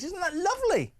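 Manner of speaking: high, sing-song sarcastic cooing; gushing, very high-pitched fake approval; mock delight. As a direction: coo it with exaggerated sweetness. Sarcastic close of mock news report.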